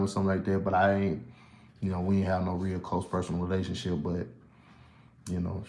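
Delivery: soft, low and subdued; quiet grief for a colleague; somber. Real, not acted. Remembering the late comic Erica Ash, soft and low.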